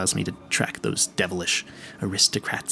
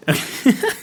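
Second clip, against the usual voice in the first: high, delighted little laugh; laugh, flattered and proud; pleased laugh; 4 dB louder than usual. Acted. Proud of guessing the desk wood, a high delighted laugh.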